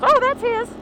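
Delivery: put-on high, light girl's voice; mock-innocent girlfriend voice; imitating Candy. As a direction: chirp it innocently. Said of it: "Candy looks at him and goes".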